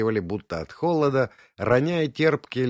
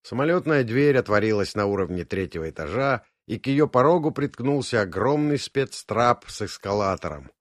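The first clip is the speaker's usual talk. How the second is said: usual speaking voice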